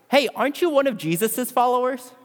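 a high-pitched question, a hint of a young girl; lightly voiced young girl. Young girl questions Peter in the courtyard, lightly voiced and high. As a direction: ask it high, lightly imitating a curious girl. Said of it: "a young girl sees him".

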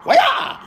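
high screeching battle yell; mock kung fu cry. Stoner hamming a kung fu fighter's war cry. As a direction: shriek it like a movie fighter.

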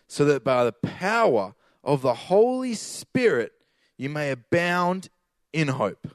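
slow, deliberate verse reading; scripture reading. Acted. Preacher reading Romans to the congregation, slow and deliberate. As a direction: lay each phrase down slowly.

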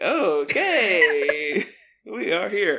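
drawn-out, loud, slow reaction; mock taken aback. Reacting to a sudden yell, drawn-out mock alarm.

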